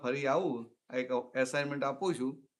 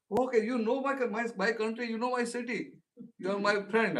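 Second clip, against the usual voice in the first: delighted excitement; 8 semitones higher, 4 dB louder, much slower than usual. Retold or imitated. Delighted by Nigerian's city.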